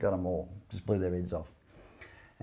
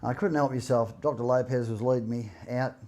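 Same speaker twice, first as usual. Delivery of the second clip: ordinary talk, nothing marked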